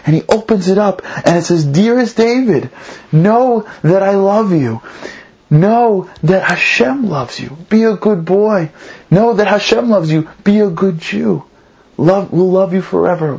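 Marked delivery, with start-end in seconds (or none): 1.6-13.4 slow, lifted voice of a loving mother; tender letter reading. Retold or imitated. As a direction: read it out slowly, each wish set apart. Mother's letter read aloud, tenderly.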